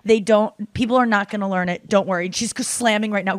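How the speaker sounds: usual speaking voice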